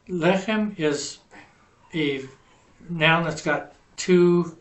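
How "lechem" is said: "Lechem" with LE punched higher, slowly; slow, stressed LE in "Lechem"; accent on first syllable. Shown as a demonstration. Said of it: "have the accent on the first syllable".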